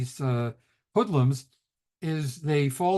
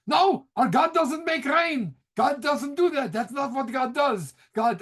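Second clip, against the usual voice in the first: put-on Gene Wilder's Yiddish accent; 10 semitones higher than usual. Rabbi answering the medicine man about rain, comic Yiddish accent. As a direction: imitate an indignant movie rabbi with a Yiddish accent. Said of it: "I love his accent".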